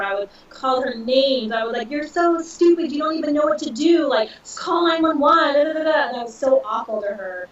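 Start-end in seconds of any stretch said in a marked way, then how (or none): 1.9-6.1 higher, louder, a mock insulting rant; retold angry screaming. Berating her mom while injured, mock angry shout. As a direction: toss off the insults in a replayed outburst. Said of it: "I screamed at her".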